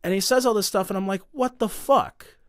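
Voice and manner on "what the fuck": drawn-out, higher-pitched mock disbelief; slow, incredulous retort, lightly acted; retold disbelief. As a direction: stretch it out, pitched up, as retold bewilderment. Acts out disbelief at the accusations.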